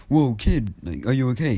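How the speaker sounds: a lightly voiced hero checking on the kid; concerned hero. All Might asks the rescued boy if he's okay, lightly voiced concern. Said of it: "All Might says".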